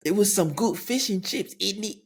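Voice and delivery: high-pitched put-on British accent. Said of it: "formulate the accent in my head".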